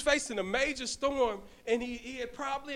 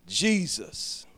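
ordinary talk

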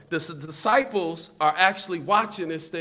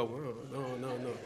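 much softer, muttered mock protest; playful protest; 3 semitones lower, 13 dB quieter than usual. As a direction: mimic a small, hushed objection. Imitates listener refusing the mustard.

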